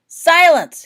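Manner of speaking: very loud, high command; commanding order. A narrator's reading. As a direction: order the portraits to stop. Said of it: "he ordered".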